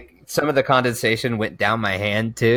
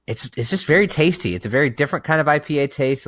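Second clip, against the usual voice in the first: normal talking voice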